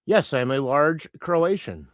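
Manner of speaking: ordinary talk, nothing marked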